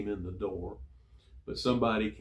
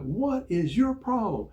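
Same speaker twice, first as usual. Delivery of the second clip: high, loud, drawn-out mock yell; slow, loud chewing-out of a recruit; retold yelling; 12 semitones higher, 5 dB louder, slower than usual. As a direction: imitate the chief yelling, slowly and high. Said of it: "he started yelling at me".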